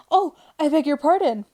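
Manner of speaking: high, loud cry of sorry; high, loud "Oh, I beg your pardon"; cried apology. Acted. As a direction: cry out in alarm. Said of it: "cried Alice again".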